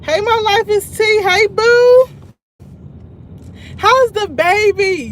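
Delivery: high, loud, excited hello to a viewer; excited greeting. Real, not acted. Streamer calling out hello to a viewer, high and loud. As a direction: sing out a greeting to a fan.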